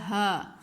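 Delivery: ہا voiced from the chest with a breathy push; chest sound. Shown as a demonstration. Teacher repeating the chest sound for students, breathy ہا. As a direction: let ہا come from the chest. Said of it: "سینے میں سے اس کی آواز آتی ہے".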